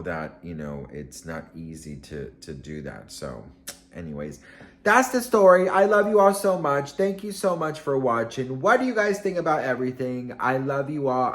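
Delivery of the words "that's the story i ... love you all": loud, high-pitched burst of affection; high, full-volume thanks, easing slightly as it goes; excited sign-off. Real, not acted. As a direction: belt out a gushing goodbye to the viewers. Upbeat sign-off to viewers.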